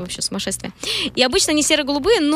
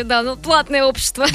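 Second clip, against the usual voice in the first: normal talking voice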